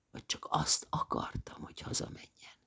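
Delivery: guilty whisper, very soft; admitting she wanted Rémi gone, whispered. A narrator's reading. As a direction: barely voice the confession. Admitting ugly wish.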